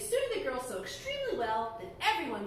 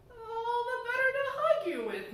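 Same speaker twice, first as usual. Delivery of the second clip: disguised wolf; 10 semitones higher than usual. Acted.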